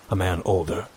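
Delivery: plain reading voice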